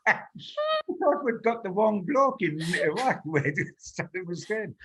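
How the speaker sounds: joking through a giggle; amused, laughing aside. Real, not acted. Laughing joke about the gaffe.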